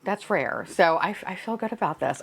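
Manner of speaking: ordinary delivery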